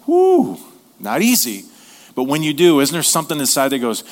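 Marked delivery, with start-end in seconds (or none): 0.1-0.6 high loud whoo. Real, not acted. Reacting to forgiving someone.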